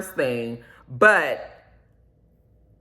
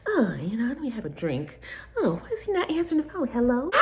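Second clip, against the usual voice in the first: lightly voiced woman muttering to herself, softer; talking to herself; 5 semitones higher, 6 dB quieter, faster than usual. A stood-up woman alone at a cafe, soft mock self-talk. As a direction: play her killing time alone at the table. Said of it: "talking to yourself like".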